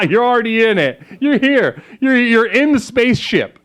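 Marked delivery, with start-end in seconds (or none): none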